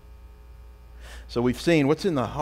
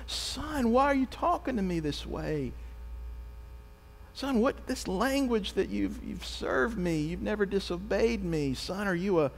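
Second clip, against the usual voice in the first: higher, soft, pained father's voice; gentle pleading father; 8 semitones higher, 4 dB quieter than usual. Retold or imitated. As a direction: plead tenderly with a wayward son. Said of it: "can you picture the father saying".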